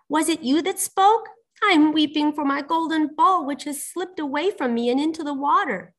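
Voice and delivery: high-pitched princess lament; tearful storybook princess. A narrator's reading. Voices the weeping princess.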